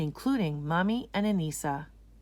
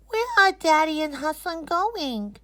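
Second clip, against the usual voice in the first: put-on high, louder child's question; puzzled little girl; 11 semitones higher, 6 dB louder than usual. Anisa asking Mommy where they went, high child voice. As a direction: ask it like a confused small girl.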